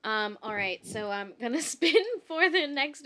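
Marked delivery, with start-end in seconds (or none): none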